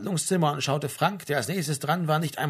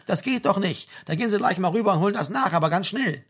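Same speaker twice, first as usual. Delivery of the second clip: higher, fast, fussing sergeant; scolding army sergeant; 5 semitones higher than usual. Acted. Sergeant orders soldier to get examined, scolding. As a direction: lecture the conscript with rising impatience.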